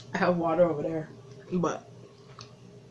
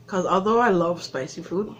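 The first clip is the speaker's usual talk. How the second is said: usual speaking voice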